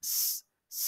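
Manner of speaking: "স" as a long isolated hiss; pure S sound. Shown as a demonstration. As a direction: hold "স" as sss. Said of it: "sound এস মানে".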